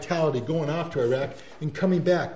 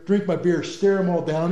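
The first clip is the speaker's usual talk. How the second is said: ordinary talk, nothing marked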